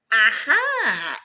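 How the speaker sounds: loud playful aha; mock aha. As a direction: act out the discovery. Mock discovery.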